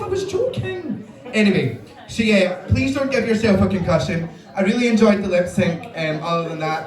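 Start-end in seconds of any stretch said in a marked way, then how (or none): none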